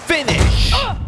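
high triumphant shout; excited shout. Real, not acted. Streamer landing a takedown, triumphant high shout. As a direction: cry it out as the move lands. Finishing move in game.

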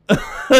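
quick burst of startled laughter; short surprised laugh. Real, not acted. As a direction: laugh out in disbelief. Laughing at plane spawning.

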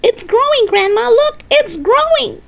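put-on very high, thrilled boy; excited yelling. George shows Grandma the growing hen, excited yell. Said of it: "he yelled".

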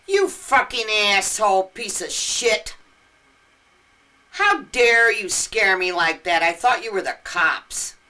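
loud, slow, tough-guy tirade; retold furious cursing. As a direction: snap back at the attacker with swagger.

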